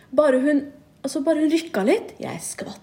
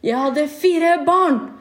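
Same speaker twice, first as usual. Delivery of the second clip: slow, higher lecturing mock mother; imitating mom; 5 semitones higher, much slower than usual. Mom scolding her daughter for sleeping late, slow mock voice. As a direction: drag out every word like a scolding.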